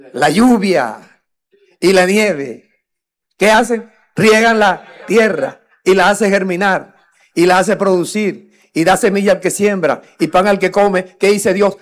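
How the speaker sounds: preacher thundering the scripture to his flock; full-force preaching. Acted. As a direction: proclaim the verse to the congregation.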